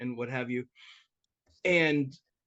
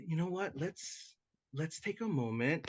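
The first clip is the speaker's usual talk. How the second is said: softer, calming tone to de-escalate; brought it down; 6 dB quieter than usual. Retold or imitated. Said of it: "I changed the inflection of my voice".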